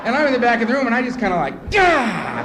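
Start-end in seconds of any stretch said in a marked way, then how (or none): 1.7-2.4 loud, high cry of shock; acted jolt of alarm; startled yelp. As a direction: yelp as if caught off guard. Startled at the back of room.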